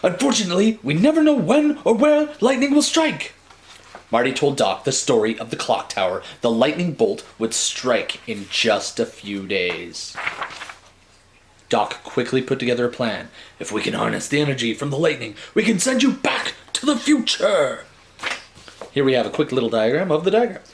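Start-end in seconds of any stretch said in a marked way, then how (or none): 0.0-3.3 much higher, louder Doc in a put-on Brooklyn accent. Doc warning about lightning, high put-on Brooklyn accent. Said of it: "I don't know why he's from Brooklyn". 13.6-17.8 a hint of excited inventor; lightly voiced Doc. Doc explaining his lightning plan, lightly voiced fast and rising.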